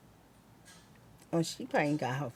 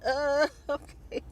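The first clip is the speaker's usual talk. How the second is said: laughing okay; 9 semitones higher, slower than usual. Retold or imitated. Baffled by salesman's answer.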